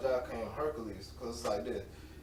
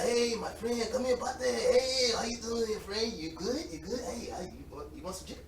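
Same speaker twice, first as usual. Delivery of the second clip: lightly voiced friendly greeter, pitched up; imitating Rambo; 7 semitones higher than usual. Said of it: "He'd be like".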